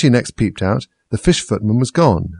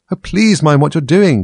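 high, louder frightened plea; terrified cry; 7 semitones higher, 6 dB louder, slower than usual. A narrator's reading. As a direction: cry out in terror. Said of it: "cried Alice".

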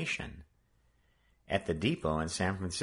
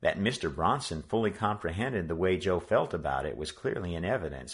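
usual speaking voice

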